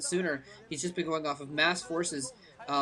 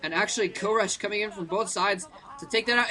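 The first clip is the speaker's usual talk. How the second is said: ordinary talk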